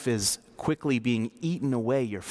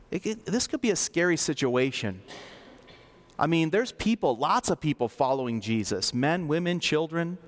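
usual speaking voice

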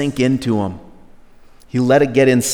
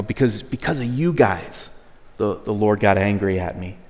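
softer, muttered "because of you guys"; quiet bitterness; 5 semitones lower, 6 dB quieter than usual. Retold or imitated. Moses quietly bitter at the people, soft resentful "because of you guys". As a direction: let the blame come out low and bitter. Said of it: "some bitterness here in his heart".

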